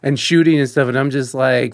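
ordinary talk, nothing marked

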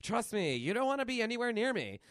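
put-on tough girl warning. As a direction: warn him off, fast at the end. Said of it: "she's like".

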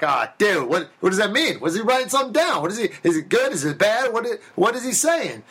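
high, anxious inner panic; freaking out. Retold or imitated. Nervous actor at his audition, panicked high inner voice. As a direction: spiral into nervous questions. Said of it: "I'm freaking the fuck out".

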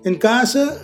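ordinary talk

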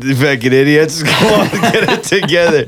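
high-pitched Canadian caricature; mock Canadian accent. Mock Canadian locals arguing over name.

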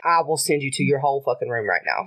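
low, controlled warning; stern mom threat. Retold or imitated. Mom to daughter who called her birth giver, stern threat. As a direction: drop low and lay down the law.

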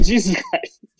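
pleading. Retold or imitated. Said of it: "And I'm like".

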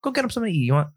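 hint of a fussing Italian mama; Italian mother voice. A movie mother shooing Phil to the kitchen, mild Italian accent. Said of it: "you are now being canceled".

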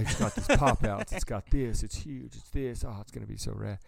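husband showing off new trailer, giddy; gushing husband. Retold or imitated. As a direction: rave about the trailer like a giddy kid.

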